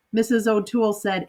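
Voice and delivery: ordinary delivery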